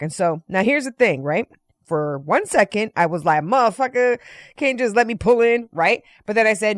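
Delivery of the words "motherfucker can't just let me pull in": a mock indignant outburst, raised; irritated muttering. Annoyed at being sent round back, mock indignant outburst. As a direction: fake outrage at the worker. Inner irritated reaction retold.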